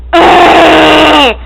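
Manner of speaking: loud grunt; friend's grunt noise. Retold or imitated. Girl imitating her friend's silly grunt call. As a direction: bark out a grunt. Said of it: "Hers is".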